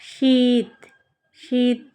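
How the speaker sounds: শীত with the ঈ held long; long vowel demo. Shown as a demonstration. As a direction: hold the vowel in শীত before the final ত. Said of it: "ইটা একটু বেশি সময় উচ্চারণ হচ্ছে".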